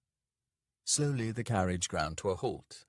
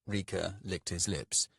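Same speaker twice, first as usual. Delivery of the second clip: usual speaking voice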